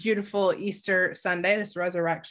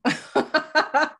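laughing; 8 semitones higher, 4 dB louder than usual. Real, not acted. Laughs at own joke.

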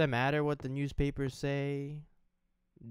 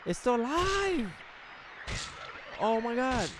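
loud high frightened yelling; panicked shouting; 7 semitones higher, 7 dB louder than usual. Real, not acted. Gamer attacked by a revived monster, shouting.

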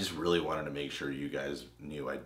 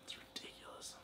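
breathy whispered aside, close to the mic; sultry whisper; 22 dB quieter than usual. Acted. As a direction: whisper it like a flirty secret. Flirty teasing, turned on.

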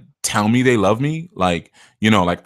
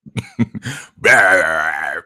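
big laugh; 3 semitones lower, 3 dB louder than usual. Real, not acted. Laughs at his burping joke.

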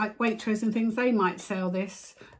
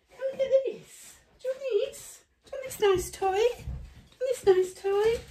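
much higher sing-song coaxing; high dog-talk voice. Real, not acted. Owner luring Milo out with a toy, high baby talk. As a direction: lure the puppy with an excited squeak.

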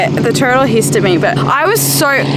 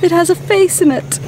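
mock dreamy boyfriend; 8 semitones higher than usual. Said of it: "he's like".

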